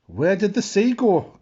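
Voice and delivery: high, loud panicky question; hammed-up fright; alarmed cry. A narrator's reading. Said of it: "cried Lanky Lou".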